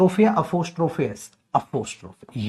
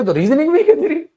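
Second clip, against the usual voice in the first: high, loud mock teasing; high teasing jab; 13 semitones higher, 7 dB louder than usual. Real, not acted. Teasing the kid.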